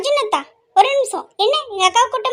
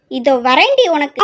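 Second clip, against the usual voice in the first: much lower, louder playful menace; mock threat; 9 semitones lower, 4 dB louder than usual. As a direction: growl it like you're coming for her. Sister threatens while chasing.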